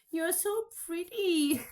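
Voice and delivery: gushing. Retold or imitated. Telling Jesus in the drawing, a high gushing compliment. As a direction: gush over him adoringly. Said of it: "And I tell him".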